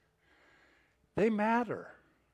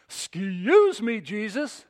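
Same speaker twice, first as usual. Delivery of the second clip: high, loud exasperated complaint; hammed-up outrage, building to a fast outburst; indignant Martha; 9 semitones higher, 10 dB louder than usual. Preacher playing Martha dumping on Jesus, loud indignant complaint. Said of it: "That was Martha saying".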